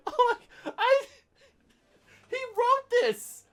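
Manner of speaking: high-pitched words through laughter; can barely speak for laughing. Real, not acted. Podcaster giggling in disbelief at the review, laughing. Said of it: "I can't help but laugh".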